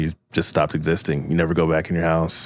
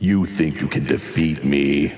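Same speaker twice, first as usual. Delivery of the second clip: low, slow villain challenge; villain taunt; 5 semitones lower than usual. Acted. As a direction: sneer at the upstart hero.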